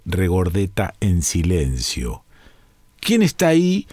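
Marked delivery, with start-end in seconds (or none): 3.0-3.9 high hissing bird voice; sibilant voice. A narrator's reading. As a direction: hiss it out high and sharp. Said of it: "dijo finalmente el pájaro con voz sibilante".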